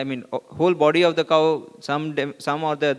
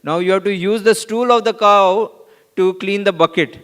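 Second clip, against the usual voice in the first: playful retold quip, louder and a bit higher; jokingly; 5 semitones higher, 7 dB louder than usual. Prabhupada teasing the devotees at the well, jokingly louder. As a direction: deliver it as a teasing joke. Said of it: "Srila Prabhupada jokingly said".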